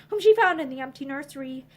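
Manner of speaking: plain reading voice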